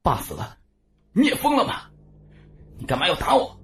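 high, sharp shout back; angry retort. A narrator's reading. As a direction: fire back in outrage. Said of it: "半晌才怒道".

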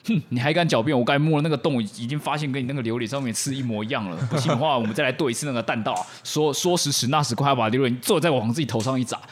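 a boy accusing a girl, hammed-up anger; indignant accusation. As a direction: confront her with mock outrage. Hao Li accuses Dingding angrily.

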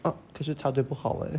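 soft, meek protest; a scared little objection; timid. Retold or imitated. Scared girl quietly protesting to the tough guy. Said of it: "我就怕他打我".

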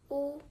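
"wu" held high and level; first tone wu. Shown as a demonstration. Teacher modelling the first tone word "wu" for learners. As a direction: sing "wu" on one high steady pitch. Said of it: "The first Chinese word with first tone is".

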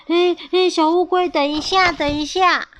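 high, urgent storybook call; calling after someone. A narrator's reading. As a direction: call the departing turtles back. 阿黄叫住离开的乌龟. (高声叫住对方)

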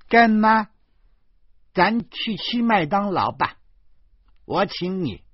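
put-on high, loud child voice; little girl. A narrator's reading. Little devil inviting godmother to breakfast, high and loud. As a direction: pipe up loudly like a small girl.

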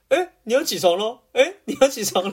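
imitating a snide roommate; sarcastic wake-up call. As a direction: needle him awake with mock sweetness. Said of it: "但你那种有点嘲讽是".

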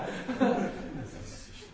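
soft airy chuckle that fades; breathy laugh. Real, not acted. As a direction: quietly laugh it off. Laughs at followers' pretension. (嘲笑那些人时轻声一笑)

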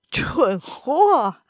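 a sharp, disdainful curse; looking down on him as you say it; contemptuous insult. A narrator's reading. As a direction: spit out the insult with scorn. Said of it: "轻蔑地骂了一句".

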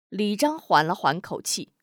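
usual speaking voice

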